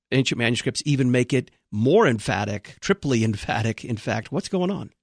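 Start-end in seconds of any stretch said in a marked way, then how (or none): none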